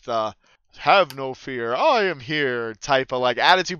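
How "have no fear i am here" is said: lightly voiced sinister hero; a hint of sinister heroic bravado; All Might. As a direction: declare it like a heroic dragonborn, drawing out the end. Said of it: "picture if All Might from My Hero was a dragonborn".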